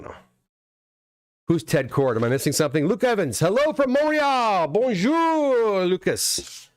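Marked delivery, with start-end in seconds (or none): 3.4-6.7 put-on terrible French accent. Host greets viewer Luke from Montreal, terrible French accent. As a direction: call out brightly in a hammed-up French accent. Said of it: "With my terrible, terrible French accent".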